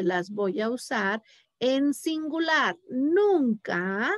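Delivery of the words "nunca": usual speaking voice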